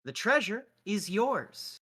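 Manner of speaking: grand declaration. Acted. Grand speech to the captain.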